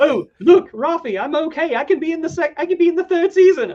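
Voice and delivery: high, loud mocking character voice; mock revived character. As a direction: squeal it like the resurrected hero.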